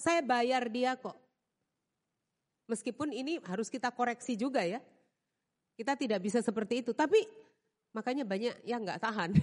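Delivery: ordinary talk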